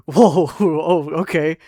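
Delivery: mock-alarmed backing off; taken aback. Worker to coworker after a kill threat, taken aback.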